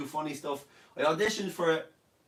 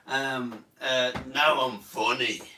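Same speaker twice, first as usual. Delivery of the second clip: ordinary talk, nothing marked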